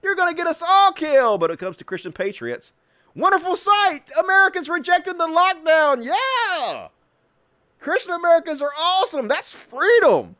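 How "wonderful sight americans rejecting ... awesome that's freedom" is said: high, gushing mock praise; sarcastic cheer. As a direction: gush like a fake patriot fan.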